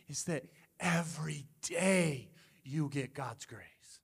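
soft, slow whisper; whispered emphasis. Acted. Pastor whispers God's daily grace to the church. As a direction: lean in and whisper it. Tender climax of sermon.

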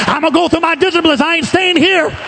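rising from high preaching to a ringing shout; building preacher's climax. Acted. Preacher rousing the church out of the dry place, building to a shout.

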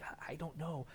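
quiet unsure whisper back; hushed reply. Acted. Disciple answering the huddle, hushed and unsure. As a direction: murmur it, clueless.

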